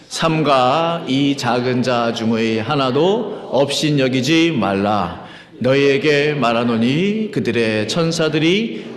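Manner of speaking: slow, measured reading with stretched phrase ends; deliberate unison scripture reading. Real, not acted. Pastor leading the congregation through four verses together, deliberate reading. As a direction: read each phrase out distinctly for others to follow. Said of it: "다 같이 또박또박 네 구절 같이 읽겠습니다".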